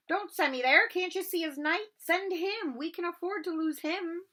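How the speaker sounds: squeaky soldier barking orders; bossy chessman. Acted. Chessmen shouting advice at Harry.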